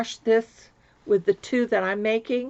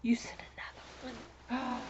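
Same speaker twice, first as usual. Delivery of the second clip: whispering; 13 dB quieter than usual. Real, not acted. Hushed surprise at another gift.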